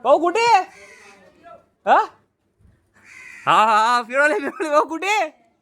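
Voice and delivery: high, playful peekaboo voice; playful calling. Real, not acted. Playing hide-and-seek with child. (खेळकर, उंच आवाजात हाक)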